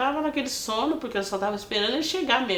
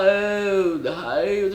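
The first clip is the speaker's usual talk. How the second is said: imitating husband calling; 5 dB louder, much slower than usual. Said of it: "sabe o jeito dele, fala".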